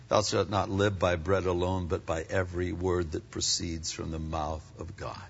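low, soft and steady recitation; quiet scripture quote. Retold or imitated. Jesus answering the devil with a quiet quoted verse. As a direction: lay the verse down softly, like a final word. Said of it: "Here's what God's word says".

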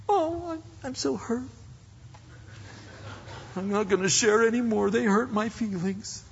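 high, whiny mock hurt voice; hammed-up wounded whimper. Pastor mimics an offended believer who quits sharing, whining high.

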